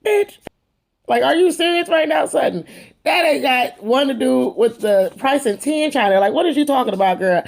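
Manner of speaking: sassy disbelief. Retold or imitated. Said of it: "Kyle looks at her like".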